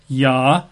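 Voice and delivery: Yahuwah split into Ya, hoo, wah with long gaps; three separate syllables: Ya... hoo... wah. Shown as a demonstration. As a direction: sound out Yahuwah one syllable at a time. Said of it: "Three syllables".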